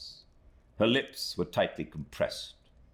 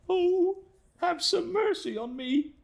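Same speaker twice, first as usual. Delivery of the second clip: put-on much higher, louder desperate young woman; crying out for mercy; 4 dB louder than usual. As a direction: cry out begging for mercy. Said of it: "cried Emily".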